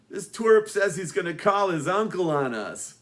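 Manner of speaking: bully taunting his friend about the nerd; mocking bully. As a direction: sneer it like a school bully.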